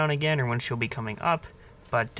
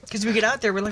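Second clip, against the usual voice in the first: ordinary talk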